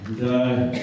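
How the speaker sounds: ordinary talk, nothing marked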